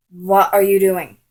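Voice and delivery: loud, falling "What are you doing"; indignant confrontation. Retold or imitated. Girl catches him kissing another, loud indignant demand. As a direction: demand it loudly without lifting the pitch. Retold confrontation after seeing kiss.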